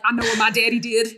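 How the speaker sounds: mock Texan movie-character accent. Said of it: "They're out here doing Texan accents".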